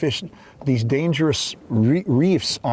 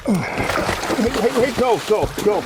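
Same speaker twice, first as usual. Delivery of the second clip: urgent calls; 8 semitones higher, much slower than usual. Real, not acted. Landing a big muskie, urgent.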